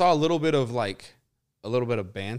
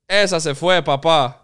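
loud, high Spanish home-run call; reenacted commentary; 6 semitones higher, 11 dB louder than usual. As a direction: yell it like the guy filming, in Spanish. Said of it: "I'm gonna reenact it".

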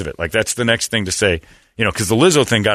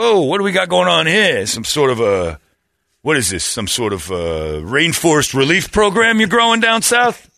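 gangster's moll; 5 semitones higher than usual. Retold or imitated. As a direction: ham up a mobster discovering hair. [name] voiced, mocking in bed.

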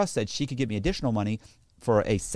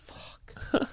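whispered curse; 7 dB quieter than usual. Retold or imitated. Dismayed inner reaction.

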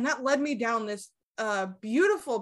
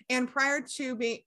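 normal talking voice

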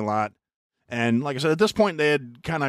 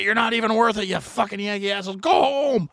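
higher, louder sneer; dismissive taunt; 10 semitones higher, 5 dB louder than usual. Retold or imitated. Locals dismissing the Yankees, high and sneering. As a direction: wave them off with contempt. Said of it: "they were just kinda like".

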